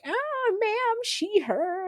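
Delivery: high, soft mock-polite customer. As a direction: pitch way up, hesitant and sweet. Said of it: "people are still be like".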